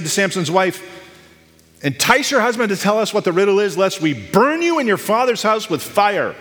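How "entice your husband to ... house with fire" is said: high, fast-starting menacing demand; raised-pitch, acted angry ultimatum; angry threat. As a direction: read it as an angry mob's threat. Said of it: "That's angry".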